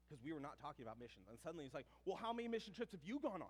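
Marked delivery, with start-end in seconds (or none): 2.0-3.5 loud, high challenge, puffed-up classmate; retold defensive outburst, raised in pitch and volume; super defensive. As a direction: fire it back as a boastful one-upping challenge. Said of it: "he got super defensive".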